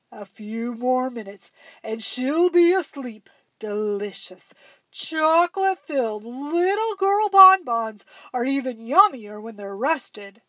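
a dinosaur licking its lips over dinner; lightly voiced greedy dinosaur; gloating dinosaur. A narrator's reading. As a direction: gloat like a hungry monster. Dinosaur gloating over sleeping girl.

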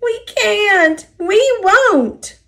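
high-pitched groaning freight cars; high, stubborn mock wail; groaning protest. A narrator's reading. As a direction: whine the refusal up high. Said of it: "the cars groaned".

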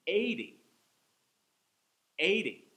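Eighty punched loud and high at the start; EIGH-ty, first syllable loud, then fall; stress on EIGH. Shown as a demonstration. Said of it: "I'm saying each one twice".

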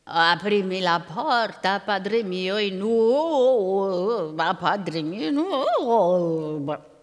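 very slow, drawn-out ogre chant; lumbering ogre singsong, vowels swooping; ogre's unready singsong. A narrator's reading. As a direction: stretch the vowels like an ogre faking a girl's call. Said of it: "la voce non era ancora pronta".

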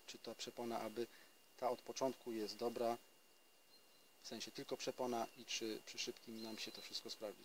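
normal talking voice

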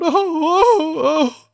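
high mock wail of pain, stretched; hammed-up childish cry, pitch leaping up; theatrical storybook howl. A narrator's reading. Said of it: "and Conrad cries out".

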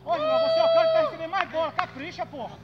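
very high, loud, fast scolding; angry yell. Real, not acted. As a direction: rant at him at full volume. Scolding teammate during match.

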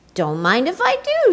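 cheerful Tacky. A narrator's reading. Tacky accepts happily.

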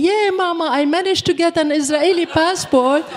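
proud little dog boasting to her mama; the dog talking. Pretend. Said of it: "And my dog saying".

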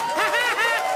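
high, loud cartoon cackle; SpongeBob's trademark giggle; SpongeBob laugh. SpongeBob laughing in his high cartoon voice. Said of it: "den Sprecher gut imitieren".

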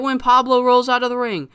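high, loud storyteller's hype; excited build-up. Real, not acted. Booker announcing who ambushes the ring, excited. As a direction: raise pitch and volume toward the big reveal.

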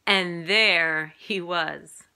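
plain reading voice

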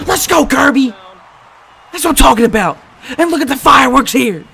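loud, high celebration; excited cheer at a score; triumphant shouting. Real, not acted. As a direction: yell it out in celebration. Celebrates a touchdown.

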